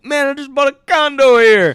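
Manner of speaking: loud, high acted protest; hammed-up indignant shout; outraged yell. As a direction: holler it like an outraged bystander. Acted outraged bystander in retold film.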